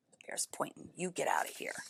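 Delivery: whispered aside to the listener; hushed whisper. Real, not acted. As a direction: drop to a whisper. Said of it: "reader whispers aside".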